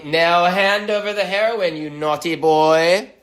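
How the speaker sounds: loud, high put-on British scolding; stern British accent. British cop exclaiming at a cornered bad guy, stern mock British accent. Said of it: "Do they exclaim sternly".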